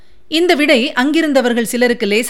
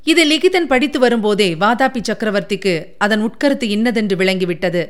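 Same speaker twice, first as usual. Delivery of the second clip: usual speaking voice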